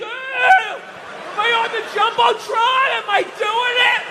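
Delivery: put-on high, shouting drunk sports fan; hammed-up rowdy fan on the Jumbotron. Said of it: "like the guys do in the upper deck".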